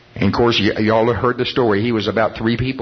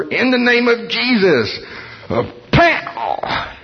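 acted-out punch; 10 semitones higher, slower than usual. Acts out Smith's healing punch.

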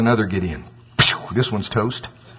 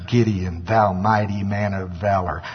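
slow, solemn pronouncement; measured divine declaration, drawn out; the Lord; 3 semitones lower, slower than usual. Acted. God assuring Gideon he is with him, slow and solemn. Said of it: "That's the way he talks".

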